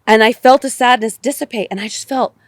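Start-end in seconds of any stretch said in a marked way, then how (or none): none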